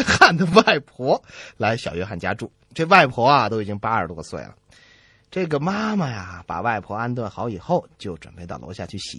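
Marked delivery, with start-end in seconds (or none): none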